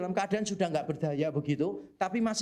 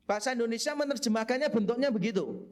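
ordinary talk

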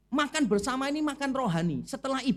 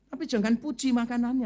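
normal talking voice

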